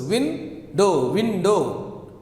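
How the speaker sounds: Window said as win, then do; split into syllables. Shown as a demonstration. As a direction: count out Window in two beats. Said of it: "Two syllables".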